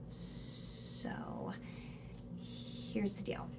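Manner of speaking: dropping to a near whisper; soft conspiratorial aside; hushed aside. Real, not acted. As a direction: lean in and let them in on it. Confiding aside to viewer.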